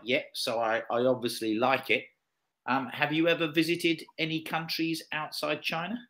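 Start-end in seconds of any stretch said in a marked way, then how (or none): none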